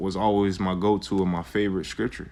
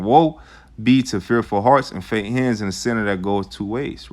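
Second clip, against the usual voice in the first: proclaiming scripture; 4 dB louder than usual. Acted. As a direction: proclaim the warning. Reads scripture aloud.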